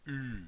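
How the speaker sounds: lips pursed as if to kiss; U with kissing lips, pushed from the chest; rounded front vowel Ü; German Ü. Shown as a demonstration. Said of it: "put your lips together as if you're gonna kiss".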